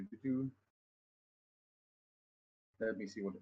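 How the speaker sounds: normal talking voice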